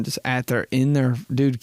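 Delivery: ordinary talk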